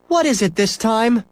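a hint of weary mother; wary mother. A narrator's reading. Mother voiced in story.

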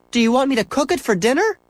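a hint of weary mother; wary mother. A narrator's reading. Mother voiced in story.

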